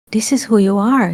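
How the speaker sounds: ordinary delivery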